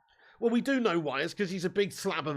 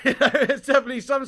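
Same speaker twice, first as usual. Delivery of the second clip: louder repeat through laughter; laughing; 7 semitones higher, 8 dB louder than usual. Real, not acted. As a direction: laugh the punchline out. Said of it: "repeats it laughing".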